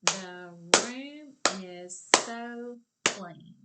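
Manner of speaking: each word called loud and slow; slow loud clapping chant. Shown as a demonstration. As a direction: clap out every word loudly. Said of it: "Say it with me and clap it".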